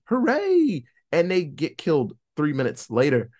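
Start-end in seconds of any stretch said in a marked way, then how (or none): 0.1-0.8 mock cheer. Real, not acted. Mock cheer at cops arriving.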